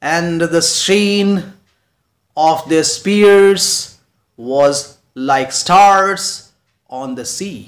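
And the sheen of their spears was like stars on the sea, very slow, stressing sheen, spears and stars; anapest scansion. Shown as a demonstration. Teacher reading an anapest example aloud, slow stressed beats. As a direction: read it slowly, hitting sheen, spears and stars. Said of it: "anapaest का example".

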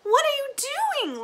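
shocked, calling out across the room; alarmed cry. Acted. Lina finding Granny tearing the couch, crying out. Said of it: "Lina cried".

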